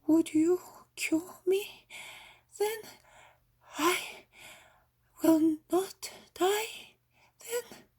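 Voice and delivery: put-on high, slow tearful old woman's plea; a frail old woman daring to hope, near tears. A narrator's reading. Sick old woman tearfully asking the healer if she'll live.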